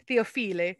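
"Theophile" as Italian-style "Theo-FEE-lee"; Italian accent. Real, not acted. Teacher repeating a French artist's name, in an Italian accent. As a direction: say "Theophile" with a playful Italian ending, "-feelee". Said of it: "saying it with an Italian accent".

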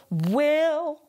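"will" pushed out from closed lips. Shown as a demonstration. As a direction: start "will" from a closed mouth. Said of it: "start from a closed spot for the W".

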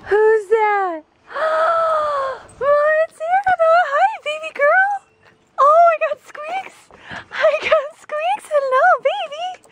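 very high, squeaky pet voice. Real, not acted. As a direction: coo at the puppy in a high squeal. Greeting her pet dog excitedly.

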